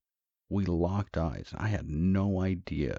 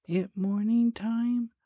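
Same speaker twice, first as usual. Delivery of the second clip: put-on very high, slow child's voice; put-on sleepy kid falsetto; little boy; 4 dB louder, slower than usual. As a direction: ask like a small child just woken. Narrator voices the waking young son.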